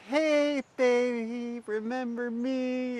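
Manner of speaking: high, slow put-on valley girl voice; imitating a prank-calling valley girl. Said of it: "intentional Valley Girl voice".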